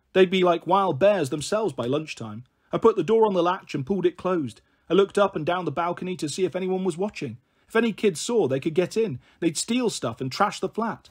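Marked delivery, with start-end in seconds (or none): none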